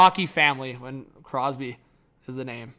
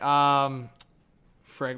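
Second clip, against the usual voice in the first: usual speaking voice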